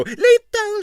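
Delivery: very high, loud brush-off; impresario. Acted. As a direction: wave it away in a high voice. Stroganov brushes off doctor.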